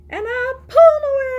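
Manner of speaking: high, loud squeal on away; squealed climax. Shown as a demonstration. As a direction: burst up high and loud as the hands come free. Climax of action song.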